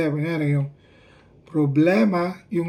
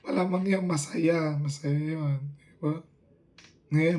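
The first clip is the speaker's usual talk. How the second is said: normal talking voice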